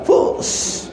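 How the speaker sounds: a soft breathy whoosh, like something gliding off. Retold or imitated. Telling how the wheelless carriage sets off, a breathy whoosh.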